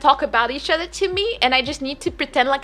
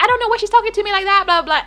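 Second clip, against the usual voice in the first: quick, high, louder huffy complaint; mock indignant complaint; 8 semitones higher, 4 dB louder, much faster than usual. Boss complaining about the accounting lady, fast and huffy. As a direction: rattle it off fast and offended.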